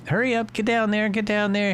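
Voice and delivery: high urgent urging. Real, not acted. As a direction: hurry the plane down. Urging the plane, rushed.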